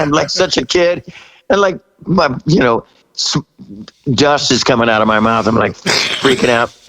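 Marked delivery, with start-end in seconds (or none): none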